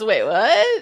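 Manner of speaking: amused rising question of disbelief; retold double-take. Imitating Olivia's reaction to Ben, incredulous. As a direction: playfully imitate being caught off guard.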